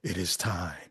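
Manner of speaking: very deep, hushed voice of God; James Earl Jones. Retold or imitated. Host mimicking the preacher as the Father, deep and hushed. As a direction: drop way down low and soft, solemnly. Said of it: "you know, James Earl Jones".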